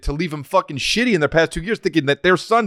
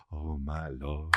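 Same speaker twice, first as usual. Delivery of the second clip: low, slow, soft Oh my Lord; hushed gospel murmur; quiet mock gospel; 11 semitones lower, 15 dB quieter, much slower than usual. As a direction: drop to a hush like the choir. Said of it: "then they get quiet and they go".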